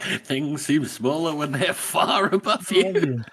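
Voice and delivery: slow, lofty mock put-down; smug roast. Podcaster voicing Baez's slow, smug comeback. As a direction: drawl it down from above. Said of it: "keeps coming back with the roast".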